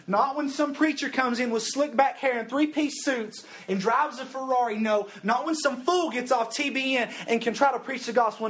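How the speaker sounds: loud, high, driving preacher's cry; preaching at full force. Acted. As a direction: preach it hard to the youth crowd.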